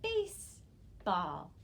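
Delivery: "baseball" very slow, with a pause between base and ball; teacher sounding out "baseball", base, pause, ball; syllable blending demo. Shown as a demonstration. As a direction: stretch "baseball" into two separate syllables. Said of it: "trace my finger and we'll say".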